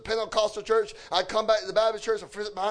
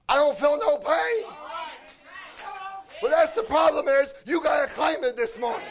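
forceful, raised-pitch sermon delivery; punchy revival preaching; fervent preaching; 4 semitones higher than usual. Real, not acted. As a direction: preach it hard to an answering congregation. Preaches to the congregation.

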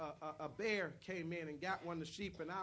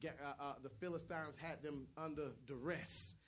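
ordinary talk

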